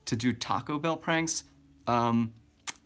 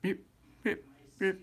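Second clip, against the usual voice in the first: Milton impression; 8 semitones higher than usual. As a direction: mumble the yeps way up high and barely audible. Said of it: "I just did the same thing".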